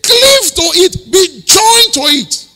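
slow loud preacher shout; fervent slow shout; shouted preaching. Acted. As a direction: cry it out to the congregation.

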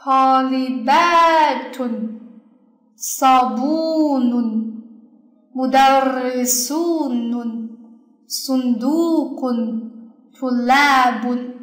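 very slow model reading, long stressed ba, bu, su, du, la in Polibatun sabunun mudarrisunun sundukun tulabun; stressed long syllables. Shown as a demonstration. Teacher rereads examples for the class, Polibatun sabunun mudarrisunun sundukun tulabun with drawn-out stressed long syllables. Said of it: "我再为大家读一下这几个例子啊，".

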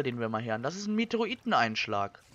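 ordinary talk

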